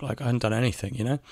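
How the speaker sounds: ordinary talk, nothing marked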